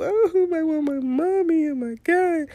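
put-on high, slow whimper of a pitiful little boy; whiny child. Son on FaceTime missing mom, whiny and pitiful. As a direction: whine like a tired kid missing mommy. He's still really pitiful.

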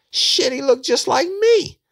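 very high, loud and drawn out; excited exclamation. Retold or imitated. Suspect describing the killer to detective, excited. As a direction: crow it out slowly, imitating an overexcited witness.

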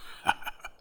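breathy demon chuckle; demon laughing. A narrator's reading. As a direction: laugh at the tiny foe. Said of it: "the demon burst out laughing".

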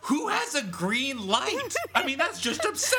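high, slow incredulous voice; squeaky outraged whine; mock exasperated reader. Mocking Gatsby's green light.

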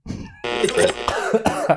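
sudden choking cough. Real, not acted. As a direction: choke on the words. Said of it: "Aż się zakrztusiłem".

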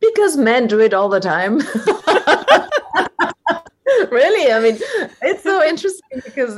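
high, amused burst of laughter through the words; laughing. Real, not acted. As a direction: answer brightly, then crack up. Laughing at her own answer.

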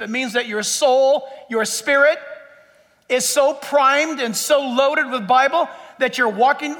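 ordinary talk, nothing marked

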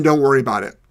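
ordinary delivery

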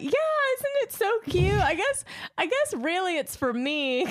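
bubbly, pleased with herself; imitating Becky. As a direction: friend proudly confirming she sent the gift. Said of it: "thank God she's like".

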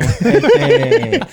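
high laughing "Este". Real, not acted. (riéndose, agudo)

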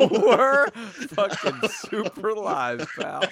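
slow, loud laughing words; cracking up mid-sentence. Real, not acted. Host echoes, laughing.

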